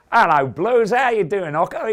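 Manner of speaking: loud, high booming hello; a giant fan's loud, high greeting; big bloke's greeting. Retold or imitated. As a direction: boom it out like a huge hearty fan. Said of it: "He goes".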